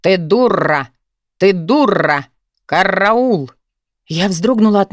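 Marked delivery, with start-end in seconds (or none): 0.0-3.5 talking parrot with rolled r's; squawking parrot. A narrator's reading. Unknown voice from the dark flat, parrot squawk. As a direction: put on a parrot voice, trilling every r. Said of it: "раздалось громко чужим голосом".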